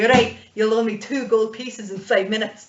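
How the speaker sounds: loud mock-Scottish dwarf; cocky Scottish dwarf, loud; terrible Scottish accent. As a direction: take the bet loudly in thick Scottish. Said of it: "a really thick, terrible Scottish accent".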